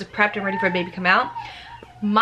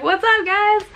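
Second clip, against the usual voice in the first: high, louder squeaky newborn greeting; cute baby voice; 11 semitones higher, 4 dB louder, slower than usual. Pretend. Mother voicing her newborn greeting everyone, high playful baby voice. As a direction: voice the baby as if he's casually saying hi.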